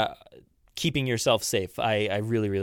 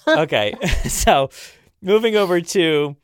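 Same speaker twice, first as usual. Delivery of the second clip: ordinary talk, nothing marked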